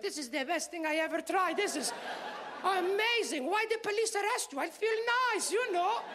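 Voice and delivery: put-on high, accented blissful mom voice; high-pitched, hammed-up happy mom; delighted stoned mother. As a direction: gush as a mother enjoying her first high. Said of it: "she was like".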